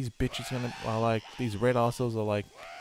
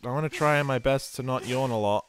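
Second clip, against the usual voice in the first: usual speaking voice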